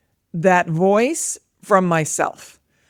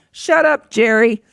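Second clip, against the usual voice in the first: raised, higher mock yell; 4 semitones higher, 4 dB louder, slower than usual. Mother yells down to daughter at homework, playful mock yell. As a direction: call it out sharply to another room. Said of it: "but I will yell down".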